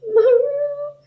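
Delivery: squeaky high moo; cat hiss. Acted. Cat woken by the sneeze, high squeal. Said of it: "who hissed".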